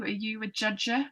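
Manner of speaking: ordinary delivery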